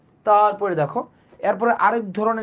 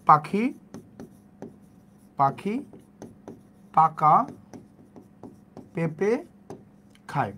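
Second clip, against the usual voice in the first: slow word by word; 3 semitones lower, 3 dB quieter, slower than usual. Shown as a demonstration. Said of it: "আমরা কি বলতাম যে".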